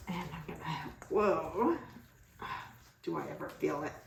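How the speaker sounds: muffled behind a mask; talking through a face mask. Real, not acted. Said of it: "I hope you can hear me through this mask".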